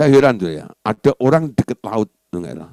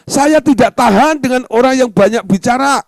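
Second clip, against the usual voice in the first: loud, high-pitched outburst; acted fed-up exclamation; exasperated complaint; 11 semitones higher, 6 dB louder than usual.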